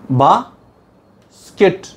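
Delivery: "बा", pause, "skit", each hit firmly; separated syllables. Shown as a demonstration. Teacher splits basket into syllables, "बा" and "skit" apart. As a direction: break "बा skit" into two stressed beats. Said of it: "बा अलग syllable है".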